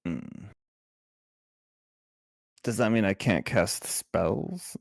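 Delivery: normal talking voice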